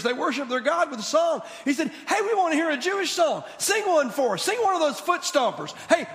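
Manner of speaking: high, loud jeering taunt; mock the captive singer, loud and high; mocking Babylonians. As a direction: taunt him like a mocking crowd. Mocking Babylonians voiced, named after.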